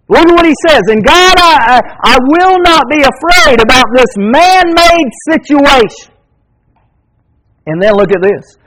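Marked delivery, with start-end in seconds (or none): 0.1-6.1 high, loud, driving preacher's cry; full-force preaching. Acted. Preacher urging his church not to fear evil, full-force preaching. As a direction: fire up the congregation.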